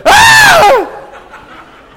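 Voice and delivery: very high, loud startled scream with a laugh; mock terrified shriek. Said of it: "And I scream".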